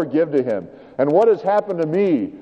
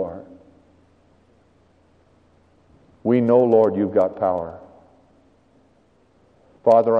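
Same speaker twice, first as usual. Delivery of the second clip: closing prayer; 6 semitones lower than usual. Acted. Pastor praying over listeners at the close.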